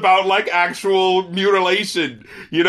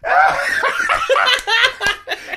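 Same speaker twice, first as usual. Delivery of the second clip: high cackling laugh. Real, not acted. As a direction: burst out laughing. Cracking up at Jerry.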